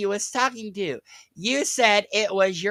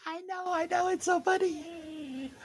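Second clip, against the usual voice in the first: high, mocking singsong, much higher; mock amused, pitched way up; sarcastic; 13 semitones higher, 9 dB quieter than usual. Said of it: "trying not to laugh my ass off".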